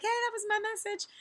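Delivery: high, bright recognition of own message; light, happy "that was my message!"; cheery "hey, that's mine". Pretend. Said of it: "you can be like".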